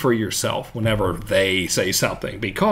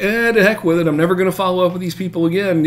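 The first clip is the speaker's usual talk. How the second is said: higher, fed-up brush-off that speeds up; frustrated; 6 semitones higher, faster than usual. Retold or imitated. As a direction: dismiss it all in mock frustration, rushing the end. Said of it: "that's exactly how I talk when I'm frustrated".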